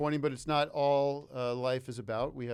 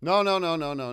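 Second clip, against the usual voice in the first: loud protest; 6 semitones higher, 9 dB louder than usual. Real, not acted. Refusing cake, shooing him back.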